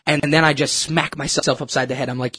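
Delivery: ordinary talk, nothing marked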